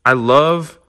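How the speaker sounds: ordinary talk, nothing marked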